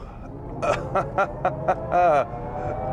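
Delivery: high, giddy laugh from acceleration; thrill-ride laughter; exhilarated laugh. Real, not acted. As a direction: laugh helplessly as the car launches. Car surges in boost mode.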